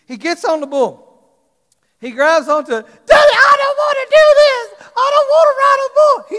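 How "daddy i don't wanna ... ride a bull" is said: put-on high, loud crying shout of a little boy; crying child. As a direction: wail it through tears like a terrified son. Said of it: "He's crying".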